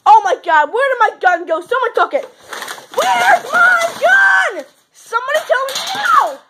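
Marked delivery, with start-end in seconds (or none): 3.0-6.4 high, very slow shouted demand; frantic demand. Pretend. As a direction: yell it out, drawn long. Sheriff demands gun back.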